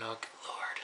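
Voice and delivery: a breathy aside of dread; whispered dismay. Retold or imitated. Watching the Big Show come out, whispered dismay. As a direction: whisper it under your breath, weary.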